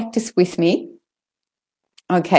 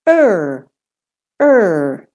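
"er" stressed, pitch curving up and down; a longer, curved, stressed "er"; 4 dB louder than usual. Shown as a demonstration. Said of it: "So the stressed is".

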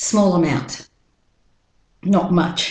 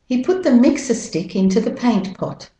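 higher-pitched British-Australian model sentence; British-Australian accent, "mixer" ends in "uh"; 4 semitones higher than usual. Shown as a demonstration. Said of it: "And with the American accent".

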